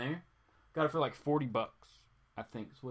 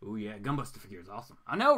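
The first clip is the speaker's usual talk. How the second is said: usual speaking voice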